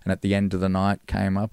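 usual speaking voice

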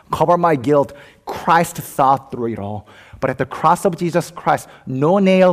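high, urgent confession from the pulpit; impassioned preaching. Real, not acted. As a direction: preach it with mounting intensity. Preaches at full force.

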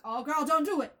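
loud, high-pitched mock warning; yelling at movie characters. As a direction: holler at the screen like a horror-movie viewer. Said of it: "most horror movies where you're like".

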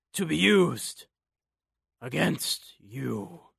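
slow, halting dying rasp; raspy dying voice. Acted. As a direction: rasp each phrase, fading out. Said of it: "voice rasped out".

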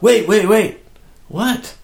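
high, urgent wait wait; a retold frantic stop call. Brian running out, calling to stop.

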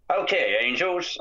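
slow, high imitation of Charlie. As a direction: drawl it like the boss on the speaker. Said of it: "I can't do that voice or whatever".

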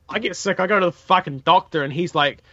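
normal talking voice

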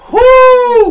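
a loud, high whoop; excited whoop. Real, not acted. Host cheering when the card says host choice, loud gleeful whoop. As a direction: cheer with glee.

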